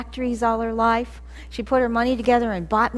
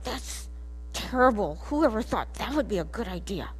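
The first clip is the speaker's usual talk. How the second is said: softer mutter under the breath; 2 semitones lower, 6 dB quieter than usual. Retold or imitated. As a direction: grumble it so mom can't hear. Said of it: "I just said under my breath".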